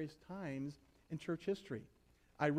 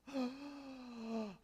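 mock gasp; 9 semitones higher, 6 dB quieter than usual. Congregation shocked at moved Christmas.

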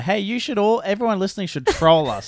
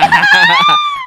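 shrieking laugh; 13 dB louder than usual. Real, not acted. Loud laughing shout.

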